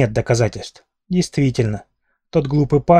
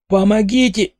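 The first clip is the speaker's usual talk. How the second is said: high strangled cry for help; much higher choked scream; trying to scream; 10 semitones higher, 4 dB louder, slower than usual. Acted. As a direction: squeeze out a scream while being choked. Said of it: "попыталась завопить я".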